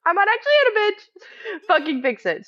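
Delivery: rising to a high furious threat; angry retold warning, going high. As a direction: pitch climbs as the anger boils over. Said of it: "And I'm pissed off".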